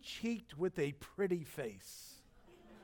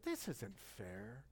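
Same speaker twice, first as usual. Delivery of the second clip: mock sulk; 7 semitones lower, 13 dB quieter, much slower than usual.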